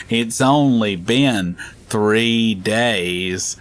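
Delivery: very slow, dragged-out complaint; odd slow caller. Retold or imitated. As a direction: stretch every word like it's urgent. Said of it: "do crazy sounding stuff".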